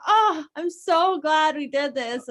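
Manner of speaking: high, loud, drawn-out gush; imitating a husband's slow, elated sigh of relief; mock delighted husband. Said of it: "Oh, it's always".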